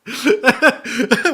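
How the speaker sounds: high loud laugh through tears; can't hold back the laugh; teary laugh. Real, not acted. Streamer laugh-crying at the anime, teary laugh.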